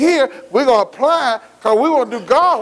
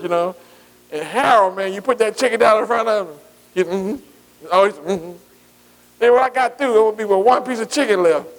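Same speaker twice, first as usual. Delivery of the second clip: ordinary delivery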